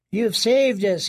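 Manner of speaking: high, relieved voice; grateful prisoner. A narrator's reading. Prisoner thanking Kyra after the escape, high and relieved. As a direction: thank her, breathless.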